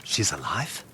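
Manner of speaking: usual speaking voice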